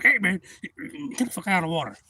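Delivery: loud, high hail easing off; retold yelling. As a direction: act out yelling a warning at someone. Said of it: "I'm like yelling at the guy".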